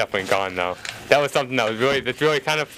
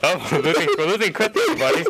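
laughing; 6 semitones higher than usual. Real, not acted. Laughing at Chris.

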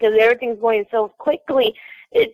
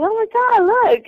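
mock surprise, higher; 11 semitones higher than usual. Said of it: "He's like".

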